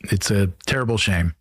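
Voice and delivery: ordinary talk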